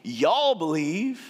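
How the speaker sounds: Texan drawl. Shown as a demonstration. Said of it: "this is Jesus' Texan".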